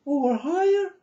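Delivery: high voice demo. Shown as a demonstration. Speaker showing viewers his high voice. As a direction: show them how high it can go. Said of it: "and you wanna go more up".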